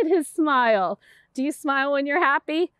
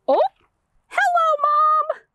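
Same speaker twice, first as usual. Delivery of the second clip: much higher, happy little fish to Mom; delighted little fish; 12 semitones higher, 4 dB louder than usual. Acted. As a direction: greet Mom joyfully. Little fish greets mother.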